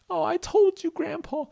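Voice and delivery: very high, squeaky protest; high-pitched little granddaughter; protesting Stella. Acted. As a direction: answer in a tiny high character voice. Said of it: "And Stella said".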